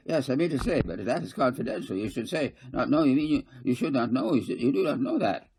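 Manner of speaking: high, loud, tripping over words; a councillor blurting out a secret; flustered blurting. A narrator's reading. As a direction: backpedal in a panic. Kading blurts and backtracks.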